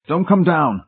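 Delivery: high-pitched, urgent snarled warning. A narrator's reading. Trapped husband warning his wife off the stairs, snarling. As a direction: snarl it as an order to keep away. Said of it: "he snarled".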